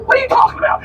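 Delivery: high-pitched retold bafflement; baffled retort. Captain asks lady what she means, baffled retort. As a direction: play up your confusion at her question.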